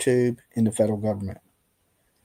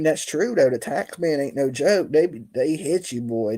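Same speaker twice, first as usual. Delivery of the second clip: normal talking voice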